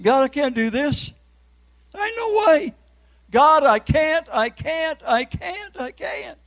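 high, breaking plea; retold sobbing prayer, pitch way up; crying out to God. On his knees in daughter's bedroom, begging God through tears. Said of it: "And I'm crying".

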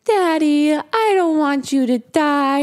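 high, slow mock-tearful child; drawn-out, high acted plea; crying little girl. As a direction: imitate a small girl pleading with Daddy. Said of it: "she's crying and she's crying".

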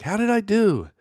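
put-on girlish squeak. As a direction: squeak it up high like an eager girl. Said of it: "the daughter of the stepmother's like".

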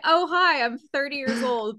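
hammed-up attempt at sounding thirty; pretend grown-up. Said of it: "pretend being like".

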